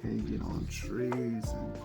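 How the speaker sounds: ordinary delivery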